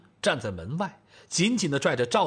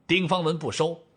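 usual speaking voice

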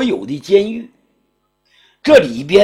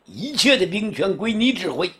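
emperor's decree; 5 semitones higher than usual. Acted. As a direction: hand down the appointment like a new emperor. Emperor appoints his marshal.

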